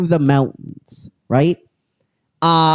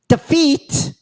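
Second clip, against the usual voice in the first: defeat jumps much higher and rises at the end; mock emphatic; 12 semitones higher than usual. Shown as a demonstration. Mocking his own retelling, defeat flung high and rising. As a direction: shoot defeat way up with a rising lilt. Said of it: "I said defeat".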